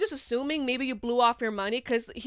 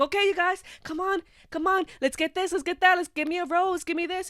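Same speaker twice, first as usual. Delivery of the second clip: high, louder pleading streamer voice; begging streamer; 7 semitones higher, 5 dB louder than usual. Retold or imitated. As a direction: beg the viewers like a needy streamer. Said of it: "he's just sitting there saying".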